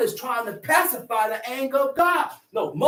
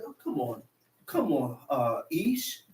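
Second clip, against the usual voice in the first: a hint of a mother pleading, lower and softer; 10 semitones lower, 7 dB quieter than usual. Preacher voices a mother pleading with her husband, lower and softer. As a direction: coax him gently like a wife begging for her son. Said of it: "Sound like a mother pleading with her husband".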